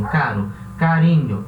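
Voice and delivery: caro e carinho com R simples batido; tapped R. Shown as a demonstration. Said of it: "Caro é de caro". (R brando em caro e carinho)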